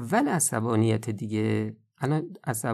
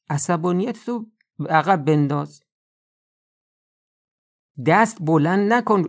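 loud, higher, stern warning; forceful command; 6 semitones higher, 5 dB louder than usual. Real, not acted. Preacher warning the congregation against hitting, forceful. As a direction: hammer home the ban on striking. Preacher urging restraint in anger.

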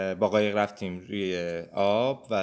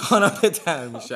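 laughing surrender; 4 semitones higher, 5 dB louder than usual. Real, not acted. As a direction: laugh through the phrase. Giving in, laughing at the phrase.

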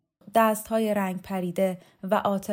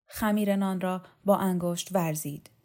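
ordinary delivery

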